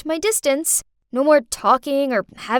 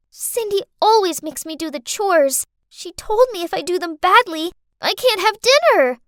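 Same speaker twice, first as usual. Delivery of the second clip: put-on high little-girl complaint, growing louder; tearful child's accusation; 7 semitones higher, 4 dB louder than usual. Girl tells her father on Cindy, tearful accusation. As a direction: whine it like a wronged kid.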